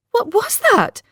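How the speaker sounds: high-pitched startled gasp of a question. A narrator's reading. Asking what drink just burned her throat, gasping. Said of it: "I gasped".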